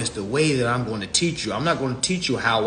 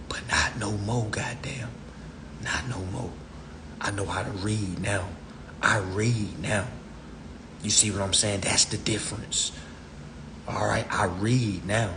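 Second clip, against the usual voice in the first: quiet boast; 5 semitones lower, 8 dB quieter than usual. Real, not acted. Quiet proud aside.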